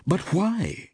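high-pitched, startled question; surprised cry. A narrator's reading. Steve hears the actress wants out, surprised cry. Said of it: "cried Steve in obvious surprise".

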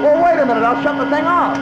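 shouting over a vacuum. Acted. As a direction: fake Russian accent yelled high and loud over the noise.

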